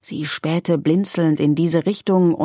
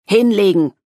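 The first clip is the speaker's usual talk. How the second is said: a loud, higher barked command; bellowed order; 4 semitones higher, 6 dB louder than usual. A narrator's reading. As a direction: roar the order to get down. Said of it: "brüllte der Mann".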